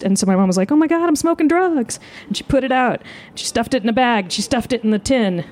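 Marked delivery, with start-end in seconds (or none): 0.7-2.0 high-pitched panicked exclamation; hammed-up alarm in a high voice; mock panic. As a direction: play mom caught red-handed, pitch way up. Mom caught by visiting priest.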